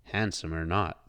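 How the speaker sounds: ordinary delivery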